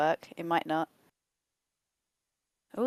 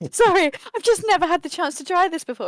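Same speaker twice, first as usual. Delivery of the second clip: higher and louder, gushing with excitement; excited gnome; 10 semitones higher, 6 dB louder than usual. Acted. Gnome gushing to the horse he can finally talk to, excited and loud. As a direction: blurt it out, starstruck.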